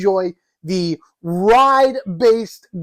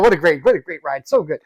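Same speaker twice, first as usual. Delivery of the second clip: usual speaking voice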